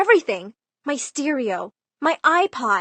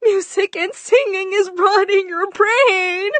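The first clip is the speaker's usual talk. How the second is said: much higher, tearful crying voice; crying mom; 9 semitones higher, 4 dB louder than usual. Retold or imitated. Mom to her daughter, crying. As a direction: sob the words out as you break down. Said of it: "My mom just burst into tears and said".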